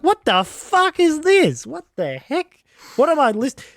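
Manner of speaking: high, slow incredulous outburst; appalled, high-pitched reaction; retold disbelief. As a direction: stretch it out in baffled disbelief.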